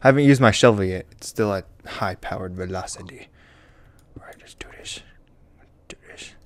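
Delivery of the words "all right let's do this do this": hushed whisper to himself. Real, not acted. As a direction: whisper it, gearing up. Psyching up before the fight.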